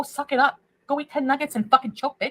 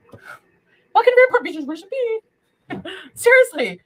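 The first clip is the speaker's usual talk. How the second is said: high taunting; 9 semitones higher, 5 dB louder, slower than usual. Real, not acted. Drunk taunting.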